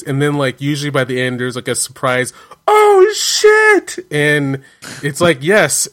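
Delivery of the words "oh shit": high, loud startled shout; shocked exclamation. Retold or imitated. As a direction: jump out of your skin. Surprise shock in movie.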